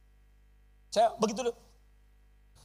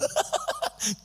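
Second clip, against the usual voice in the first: high squealing laugh. Real, not acted. Laugh alone.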